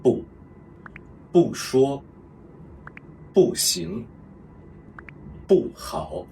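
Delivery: bu falling sharply in 不说, 不行, 不好; fourth tone bu. Shown as a demonstration. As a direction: drop bu hard from high. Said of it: "the fourth tone".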